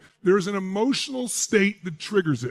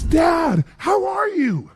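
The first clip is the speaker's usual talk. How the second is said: high, bright-eyed gushing greeting, much higher; overly enthusiastic daughter; 9 semitones higher, much slower than usual. Retold or imitated. Imitating his daughter greeting dad, overly enthusiastic, much higher. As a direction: gush it like a teen buttering up her dad. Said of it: "She's being overly enthusiastic".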